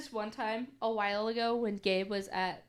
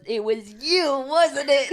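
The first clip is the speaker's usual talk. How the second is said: louder, higher accusing voice; 4 semitones higher, 10 dB louder than usual. Retold or imitated. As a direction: point the finger and accuse. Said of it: "he goes".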